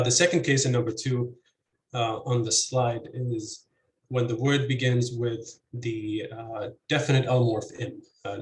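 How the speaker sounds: usual speaking voice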